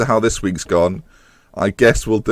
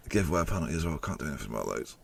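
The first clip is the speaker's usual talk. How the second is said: deflated mumble; 6 semitones lower, 16 dB quieter, much faster than usual. Real, not acted. Deflated recap.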